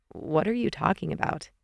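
soft, understated scoff. A narrator's reading. Evan answers Amy's shivering, scoffing mildly. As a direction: dismiss it quietly with mild disbelief. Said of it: "Evan scoffed".

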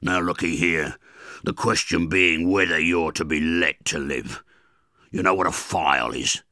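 loud, rough threatening convict voice; menacing convict. Acted. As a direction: loom over the boy and threaten him.

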